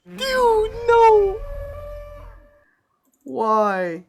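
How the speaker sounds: real despairing yelp; anguished cry. Real, not acted. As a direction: cry it out in disbelief. Still reeling from the crash.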